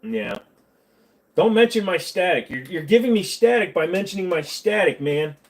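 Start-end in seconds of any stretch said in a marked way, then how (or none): 1.3-5.4 higher, louder comic exasperation; mock-annoyed protest. Real, not acted. Snaps back at remark about static.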